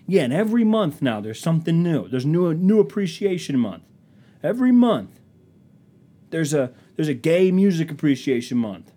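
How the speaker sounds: high, loud worked-up complaint; acted-out heated grievance, pitch climbing; mock indignant rant.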